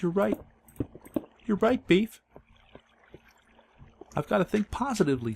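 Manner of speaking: put-on squeaky high little kid. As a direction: pitch up into a child's voice. Kid voiced in a story.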